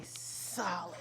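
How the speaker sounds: tense whisper, stretched out; long hissed S into a stiff whisper; hard and still. Shown as a demonstration. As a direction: whisper it while holding your body rigid. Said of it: "holding it hard and still".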